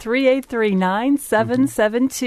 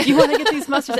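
laughing; 5 semitones higher, much faster than usual. Real, not acted. Laughing at guest's mustard request.

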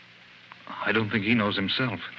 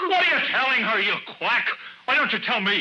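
furious shouting; 11 semitones higher than usual. Acted. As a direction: shout down the doctor in rage. Patient yells at doctor.

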